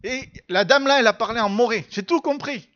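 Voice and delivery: high, forceful excited announcement; enthusiastic student. Retold or imitated. Student telling pastor he understood the lady's Mooré, enthusiastic. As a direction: burst out with it, pitch climbing to the end. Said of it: "il était tout enthousiaste".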